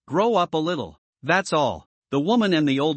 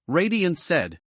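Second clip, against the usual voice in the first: ordinary delivery, nothing marked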